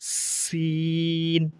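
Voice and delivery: "সিন" with a sharp s and very long vowel; hard s. Shown as a demonstration. Teacher models the letter, long hard-s "সিন". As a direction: stretch "সিন" slowly on a level note. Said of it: "এটি সিন শক্ত".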